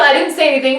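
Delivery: usual speaking voice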